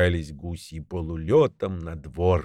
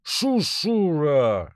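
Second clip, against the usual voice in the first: higher, slow hissing gander; drawn-out goose hiss, higher; 6 semitones higher, much slower than usual. A narrator's reading. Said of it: "шипит гусак".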